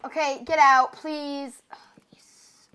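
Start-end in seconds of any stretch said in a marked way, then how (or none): 0.1-1.6 loud get-out order; yelling. Real, not acted. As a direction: shout her out of the room. Ordering sister out.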